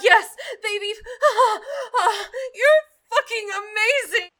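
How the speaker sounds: high, breathless cries of pleasure; ecstatic cries. Acted. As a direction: let the pleasure break loose. Performer acting out climax.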